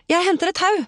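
high-pitched, urgent exclamation; urgent call. A narrator's reading. Rescuer off to fetch a rope, urgent call.

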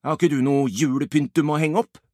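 sour. Acted. Albert brushes off Ibbi's advice, sourly. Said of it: "svarer Albert surt".

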